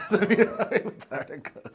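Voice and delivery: amused, giggling repeat; laughing. Real, not acted. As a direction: crack up while repeating it. Guest repeats the phrase laughing.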